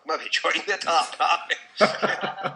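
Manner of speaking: laughing through the joke, slowing down; giggling at own joke. Real, not acted. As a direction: break into laughter mid-line. Laughing while joking.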